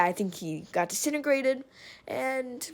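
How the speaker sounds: usual speaking voice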